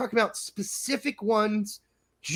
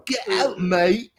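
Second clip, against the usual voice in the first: mock British accent; 2 semitones higher, 5 dB louder, slower than usual. Host mocking a British commenter, mock British accent. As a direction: jeer it like a cartoon Brit.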